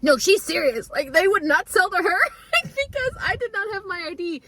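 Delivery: high-pitched, emphatic mock outrage; retold fury. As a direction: imitate indignant sister, laughter breaking in. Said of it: "my sister was furious".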